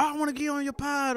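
high-pitched mock eager request; imitating eager podcasters. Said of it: "you know, everybody".

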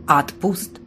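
usual speaking voice

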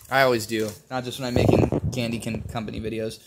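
usual speaking voice

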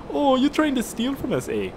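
high-pitched, hammed-up Canadian accent; attempted Canadian accent. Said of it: "Well, a Canadian military base".

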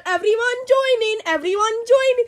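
high, loud, fast call to join; rally everyone to join in; excited rallying. Pretend. Leader rallies the chant.

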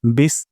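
বিস with the air pushed out on the স; airy s demo. Shown as a demonstration. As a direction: push breath through the স of বিস. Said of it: "বিস সিন থেকে আমরা বাতাস বের করব". (বিস-এ বাতাস বের করে)

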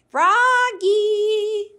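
high, loud sung call; loud singsong call. A narrator's reading. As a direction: sing his name across the snow. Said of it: "called his mother".

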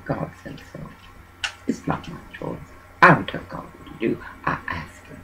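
unvoiced whisper, almost to herself; hushed aside under the breath. Real, not acted. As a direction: drop to a breathy private whisper. Muttered aside about God.

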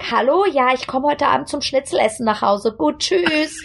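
put-on high-pitched German girl on the phone. German girl picking up the phone to mom, high sing-song German. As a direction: switch to high, chirpy German phone talk. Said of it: "we pick up the phone, we'd be like".

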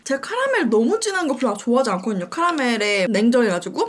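eating between words; talking while chewing. Real, not acted. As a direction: mouth partly full. Seen 2: mouth moves with food, rusk in hand throughout.

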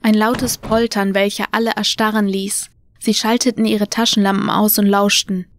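usual speaking voice

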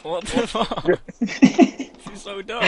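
loud, high-pitched frustration; high, incredulous yell; exasperated outburst. Real, not acted. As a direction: shout it in exasperation at the glitch. Frustrated outburst over game glitch.

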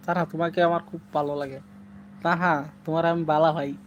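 Sylheti phrase shown as an example; Sylheti dialect. Shown as a demonstration. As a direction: show how a Sylheti speaker says it. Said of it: "এটা হলো সিলেটি ভাষা". (সিলেটি ভাষার নমুনা বলা)

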